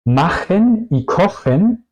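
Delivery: CH as a Spanish J in "Machen y kochen"; jota española. Shown as a demonstration. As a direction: say "Machen y kochen" slowly with a back-of-throat CH. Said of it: "pronuncio la C H como la J". ("Machen y kochen" con ch como jota española)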